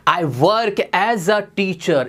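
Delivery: stretched very slowly, each word apart; slow model phrase. Shown as a demonstration. Teacher modelling a new phrase for students, very slow. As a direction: draw the phrase out for the class. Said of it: "ऐसे बोलो".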